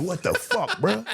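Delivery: hammed-up high-pitched what the fuck; mock disbelief. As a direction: imitate shocked outrage, way up in pitch. Reacting to friends' hating.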